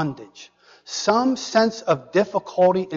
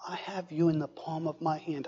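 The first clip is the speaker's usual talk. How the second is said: much softer, breathy and tender-sounding; whispered voice of God; 10 dB quieter, faster than usual. Acted. Preacher whispering God's promise to his listeners.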